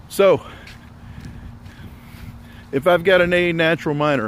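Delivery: normal talking voice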